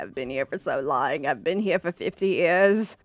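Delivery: low, soft mock despair; mock weepy. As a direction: pretend to be on the verge of tears. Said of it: "I'm gonna cry".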